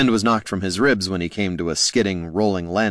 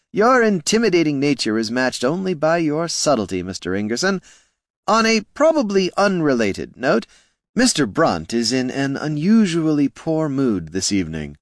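raised, silky voice with polite menace; sly gnome, mocking; 7 semitones higher than usual. A narrator's reading. Flynn taunting Gorm about his ogre, smooth menace. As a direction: flatter him while threatening.

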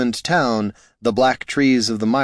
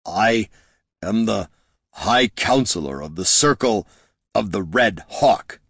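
gritted teeth. A narrator's reading. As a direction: force it out through gritted teeth. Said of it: "he spat through gritted teeth".

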